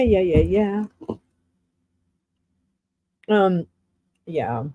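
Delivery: ordinary talk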